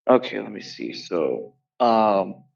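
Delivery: normal talking voice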